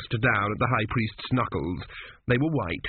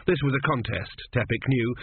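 plain reading voice

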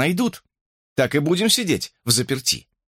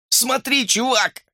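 high-pitched exclamation, little extra volume; a contained, high yell; mild shout; 11 semitones higher, slower than usual. A narrator's reading. Hamster showing his friend something, mild shout. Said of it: "гаркнул он".

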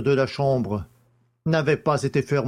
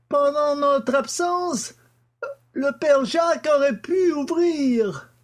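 put-on much higher, slower voice for the old scholar; Stangerson's voice; 13 semitones higher than usual. A narrator's reading. The father answers the judge's questions, in a much higher voice. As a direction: pitch up high as the father testifying.

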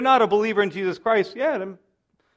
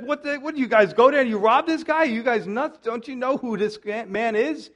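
exasperated Jacob; 6 semitones higher than usual. Retold or imitated. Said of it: "It's like".

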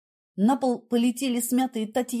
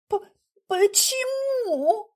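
high, drawn-out stuttered question; stammering; 9 semitones higher, much slower than usual. A narrator's reading. Heroine stupidly asks about rain, stammering. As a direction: trip on the first sound, then stretch it. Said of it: "заикаясь".

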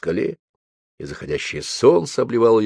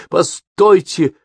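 high, loud, slow call; shouted; 9 semitones higher, 5 dB louder, much slower than usual. Acted. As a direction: call after them as you run. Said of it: "выкрикнул Алексей".

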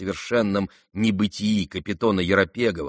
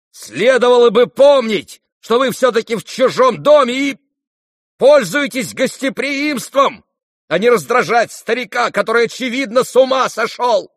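loud, very high scolding; angry shout; 13 semitones higher, 8 dB louder than usual. A narrator's reading. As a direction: shout an outraged reprimand at the guest. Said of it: "крикнул он". (гневно кричать, отчитывая)

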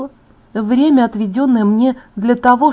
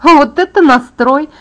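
high, loud, drawn-out approval; enthusiastic cheer; 6 semitones higher, 7 dB louder, slower than usual. A narrator's reading. Alice applauds her friend's grudging consent, enthusiastically.

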